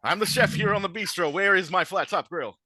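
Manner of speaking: outraged chef claiming his kitchen; indignant chef. Acted. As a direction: puff up and demand your grill back. Chef confronts the diner cook.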